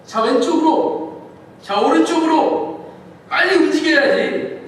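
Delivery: loud, high commands over the storm; urgent shouted commands, slow and loud; shouting orders. Acted. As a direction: bark orders at the crew over the wind.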